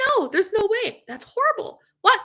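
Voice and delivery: high, loud mock disbelief; hammed-up shocked no, no, no; shocked denial. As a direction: act out refusing to believe the news. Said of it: "I was like".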